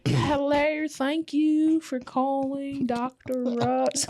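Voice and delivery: very slow, stretched-out phone greeting; sweet nurse drawling at a crawl; slow Alabama drawl. Retold or imitated. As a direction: imitate a nurse dragging out every word. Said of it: "they talk so slow".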